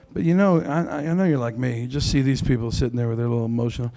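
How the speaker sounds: ordinary talk, nothing marked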